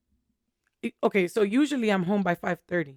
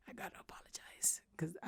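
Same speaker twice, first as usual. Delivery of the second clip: whisper; 22 dB quieter than usual. Retold or imitated. Inner thought after yelling.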